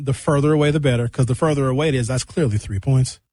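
ordinary talk, nothing marked

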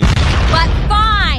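loud, grudging "but fine"; exaggerated concession. Real, not acted. Grudgingly concedes the flagrant call.

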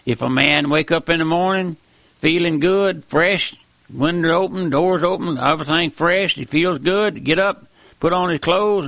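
normal talking voice

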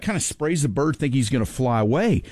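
ordinary talk

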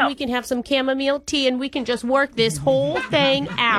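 hammed-up Long Island-Boston-Minnesota mom accent; horrible jumbled accent. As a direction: play a chatty older girlfriend in a bad regional accent mash-up. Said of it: "doing a horrible accent".

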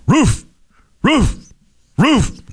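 high yappy barks, each word punched; dog barking words. Retold or imitated. Joking dog answers the bartender with high barks. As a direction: bark it out like a little dog. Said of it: "And the dog says".

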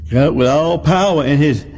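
usual speaking voice

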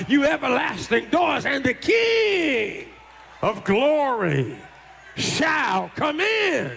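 high, stretched preacher's proclamation; drawn out and soaring; psalm proclaimed. Acted. Preacher to congregation, soaring psalm proclamation.

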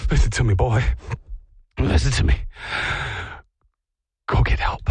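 loud, low, mock-heroic command; dramatic plea to dog. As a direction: order the dog like a movie hero. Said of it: "being like".

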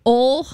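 "ol" as one syllable, like "ole"; Southern oil. Shown as a demonstration. Recalling her speech on moving here, Southern "ol". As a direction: flatten oil into "ol". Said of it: "I said "ol".

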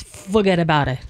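put-on low, tossed-off New York accent; mock New Yorker brush-off. Mimicking New York accent just named.

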